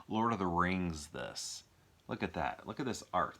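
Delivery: usual speaking voice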